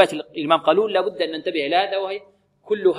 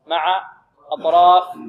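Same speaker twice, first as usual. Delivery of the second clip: normal talking voice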